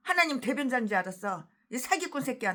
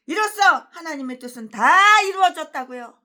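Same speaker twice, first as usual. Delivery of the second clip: louder, higher with weight on 다; loud mock quote; 5 semitones higher, 10 dB louder than usual. Preacher loudly quoting a rival pastor's claim. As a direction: declaim "이로써 하나님의 뜻은 다 이루어졌다고요" loudly, punching 다. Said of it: "뭐라고?".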